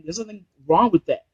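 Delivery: ordinary talk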